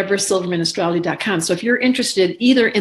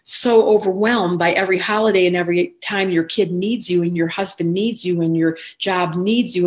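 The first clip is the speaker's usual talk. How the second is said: ordinary talk, nothing marked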